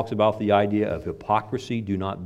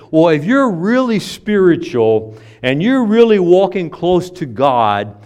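mocking pious critic; 7 semitones higher, 11 dB louder, slower than usual. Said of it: "People say".

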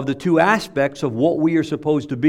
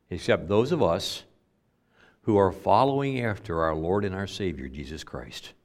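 ordinary talk, nothing marked

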